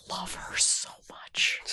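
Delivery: whispered, choked with feeling; emotional whisper. Real, not acted. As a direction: breathe it out, nearly in tears. Moved by her favourite actress.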